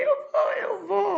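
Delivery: high, loud re-enacted cry of joy; grito eufórico. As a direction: shout it out, overjoyed, as if just hearing the news. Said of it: "eu comecei a gritar". (grito de euforia, revivendo o momento)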